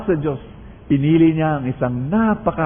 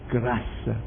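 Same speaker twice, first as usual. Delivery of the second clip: hushed answer; 5 semitones lower, 10 dB quieter than usual. Real, not acted. Quiet answer to own question.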